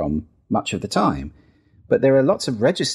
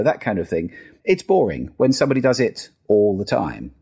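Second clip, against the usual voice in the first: normal talking voice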